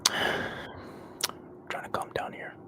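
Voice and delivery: soft, slow whisper with a sigh; whispering on the phone. Real, not acted. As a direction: whisper to calm yourself. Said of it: "Why are you whispering?".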